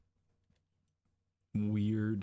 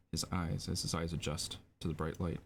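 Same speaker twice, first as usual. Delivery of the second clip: ordinary talk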